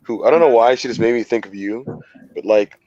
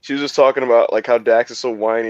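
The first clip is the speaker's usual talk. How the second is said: usual speaking voice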